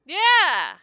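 high, very loud yeah; excited cheer. Real, not acted. Player cheering over new gear, loud high shout. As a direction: shout it in triumph.

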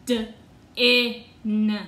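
D-in split into its sounds, the onset punched; sounded-out word. Shown as a demonstration. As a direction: break D-in apart into its sounds. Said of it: "Let's look at that word".

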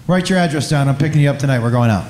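low, firm orders; a hint of a bossy older woman; commanding woman.

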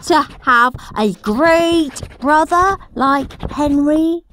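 high, gushing, mock-sincere child reading his diary; smug diary voice. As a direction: lay the sweet praise on thick. Henry's fake diary entry voice.

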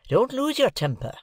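placating Joe. A narrator's reading. Master tells his journeyman to calm down, placating. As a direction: swoop up high to calm him.

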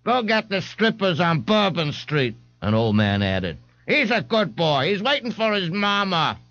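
put-on high, cracked old man's voice; an old codger sticking up for the boy. The old man defends Ignatius to the policeman, high and creaky.

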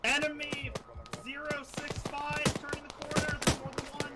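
high urgent enemy callout. Real, not acted. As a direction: call out the enemy fast.

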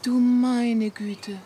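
slow breathy gasp of surprise; astonished whisper. Acted. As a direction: whisper it in disbelief. Überrascht über Neuigkeit. (staunend geflüstert)

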